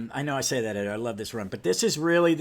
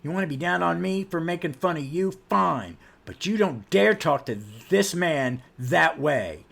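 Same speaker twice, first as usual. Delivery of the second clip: loud, indignant acted rant; angry tirade; 4 semitones higher, 6 dB louder than usual. Young hero telling off a stern superhero, loud indignant rant. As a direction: go off on them, punching the key words. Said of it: "Jack goes off on Batman".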